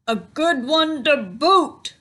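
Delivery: hammed-up crew chant; pirate crew echo. A narrator's reading. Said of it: "the others agreed".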